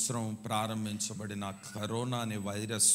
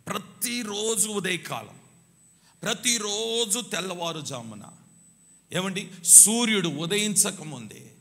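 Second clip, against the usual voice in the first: fiery preaching; 6 semitones higher, 6 dB louder than usual. Real, not acted. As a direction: preach it with full fire. Preaching at full force. (ఉద్వేగంగా, గట్టిగా బోధించడం)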